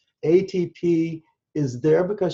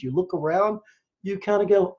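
ordinary talk, nothing marked

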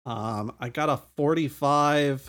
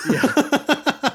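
high loud whoop; excited cheer; 13 semitones higher, 7 dB louder than usual. Real, not acted. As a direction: cheer at the joke.

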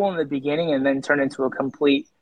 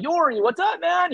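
friendly greeting; 13 semitones higher than usual. Retold or imitated. Celebrity greeting retold, much higher.

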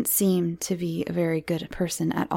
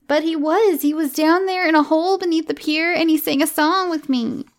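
put-on high, loud child protest; protesting little girl; 11 semitones higher, 6 dB louder than usual. Miranda insists to Mummy the man is real, protesting. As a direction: insist excitedly as a small child.

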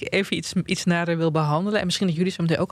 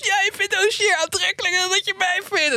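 much higher, squeaky mock-jealous whine; mocking jealous boyfriend. Host mimics her jealous boyfriend about [name], high mock whine. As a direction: mimic a sulky boyfriend, pitched way up. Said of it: "Dus mijn vriend altijd heel boos".

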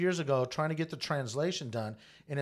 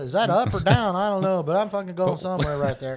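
a hint of a put-on old man, louder; lightly voiced old man, louder; mock old person voice; 4 semitones higher, 9 dB louder than usual. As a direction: bluster along like a confused old-timer. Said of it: "why are we sounding like old Chinese people".

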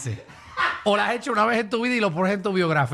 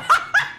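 brief, very high laugh; real laughter. Real, not acted. Host laughs after admitting the show's flaws, short high laugh. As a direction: let out a quick high laugh.

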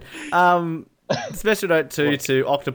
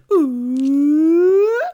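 loud, high, drawn-out ooh; high Roger Moore ooh; 8 dB louder than usual. Retold or imitated. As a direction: sing out a long high ooh. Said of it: "my message tone on my phone at the moment is".

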